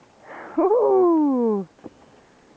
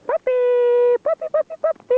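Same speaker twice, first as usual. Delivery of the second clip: high, ringing call to the puppies; 10 semitones higher than usual. Real, not acted. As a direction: sing out to the dogs to come. Calling puppies from afar.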